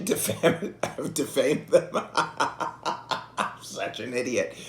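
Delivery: helpless laughter through the words; real laugh breaking up the sentence; laughing at himself. Real, not acted. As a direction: crack up at your own blunder. Laughing at his own slip.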